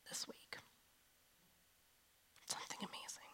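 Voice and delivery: hushed, teasing whisper; a little secret whispered to the room. Shown as a demonstration. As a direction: lean in and whisper the news. Teasing good news in sermon.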